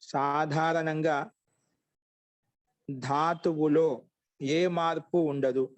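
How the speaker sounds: slow, drawn-out syllables for note-takers; slow dictation. Shown as a demonstration. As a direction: dictate slowly, pausing between phrases. (నెమ్మదిగా రాయించే స్వరం)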